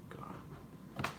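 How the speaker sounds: whispered, exasperated aside; very quiet dismay, to yourself; exasperated mutter. Real, not acted. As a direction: breathe it out under your breath. Exasperated by noise behind him.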